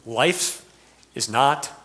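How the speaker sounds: ordinary talk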